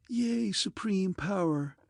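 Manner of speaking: a female robot taunting the emperor; mocking feminine voice. A narrator's reading. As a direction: draw the title out in mockery. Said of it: "uttered mockingly in a clear feminine voice".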